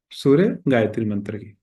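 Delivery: ordinary talk, nothing marked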